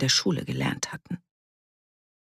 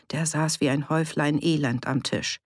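plain reading voice

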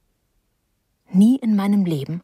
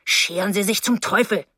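ordinary delivery

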